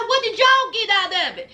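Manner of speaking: ordinary talk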